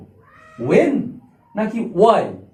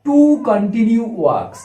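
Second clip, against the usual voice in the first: each word drawn out slowly for the class; very slow teacher dictation; much slower than usual. Shown as a demonstration. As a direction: spell it out slowly so they can note it. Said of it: "মনে রাখো পয়েন্টটা".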